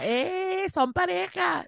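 teasing high mock voice of a friend. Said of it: "Él decía". (Ignacio burlón con voz aguda)

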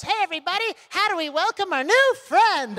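high, loud party-host welcome; rally the bus like an MC; cheerful driver. Retold or imitated. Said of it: "she said to us".

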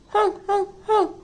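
high, playful honks; lightly voiced seal honk. Seal honking at goodbye.

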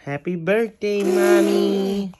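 high, slow pretend baby voice; little-kid birthday greeting; baby's voice.

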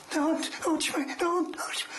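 high-pitched juddering cry, imitated; juddering scream. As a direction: act out a man being electrocuted. Retold electrocuted man screaming, rattling.

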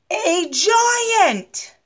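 high, loud gasp of a reveal; spring the surprise on the kids; sudden high cry of alarm; dramatic reveal. A narrator's reading.

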